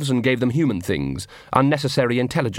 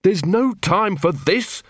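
high, slow, stretched-out snarl; 7 semitones higher, slower than usual. A narrator's reading. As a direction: snarl with urgent impatience. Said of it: "snarled Angua".

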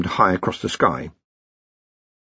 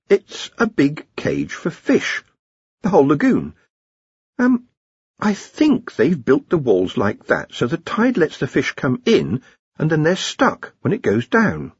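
a lightly voiced wizard, slow and thoughtful; a lightly voiced young wizard; 6 semitones higher than usual. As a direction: puzzle it out slowly, like a young scholar. Ponder explains the fish trap.

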